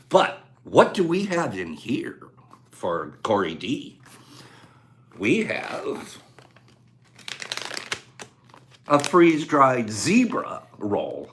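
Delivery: low, hushed, drawn-out prize reveal; suspenseful reveal. Real, not acted. Host revealing what Corey D gets, suspenseful reveal. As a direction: draw it out slowly and softly, with long pauses.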